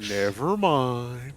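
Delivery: slow, stretched "never mind"; "never mind" at half speed, "mind" held long; drawn-out dismissal. Real, not acted. Repeats it, drawn out after laughing.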